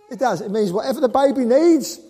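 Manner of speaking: exultant preacher's shout; emphatic preaching. Real, not acted. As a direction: drive it home to the congregation.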